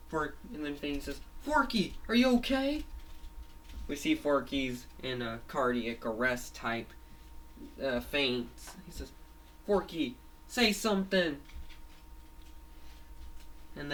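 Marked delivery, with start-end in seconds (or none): 1.4-2.8 high, raised cartoon concern; worried calling. Fangy checks on fainted Forky, worried calling. 9.7-11.4 worried plea. Acted. Fangy pleads with fainted Forky.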